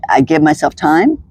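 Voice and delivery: normal talking voice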